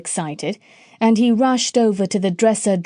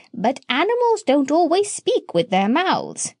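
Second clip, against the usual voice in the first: high voice; 6 semitones higher than usual. Acted. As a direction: parrot speaks in a higher voice. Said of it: "said the parrot in a high voice".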